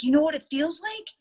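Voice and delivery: high, loud amazed question. Retold or imitated. Veteran amazed by relaxation.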